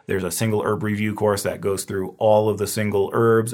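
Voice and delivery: ordinary talk, nothing marked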